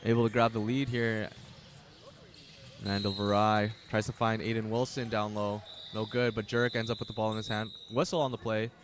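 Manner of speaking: play-by-play announcer calling the first half; live play-by-play. Acted. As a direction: keep the game call going. Live basketball play-by-play call.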